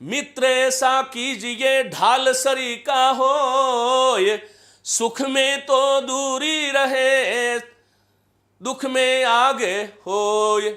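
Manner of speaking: stretched, chanting doha lines for the class to repeat; teacher chanting verse for students to echo; slow couplet recital. Shown as a demonstration. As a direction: lead the students line by line, slowly. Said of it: "बोलिए मेरे साथ".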